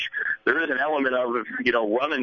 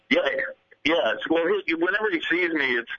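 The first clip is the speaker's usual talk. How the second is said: normal talking voice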